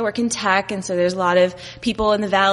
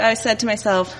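ordinary talk, nothing marked

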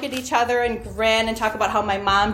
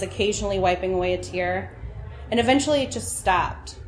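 usual speaking voice

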